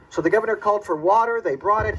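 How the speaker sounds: normal talking voice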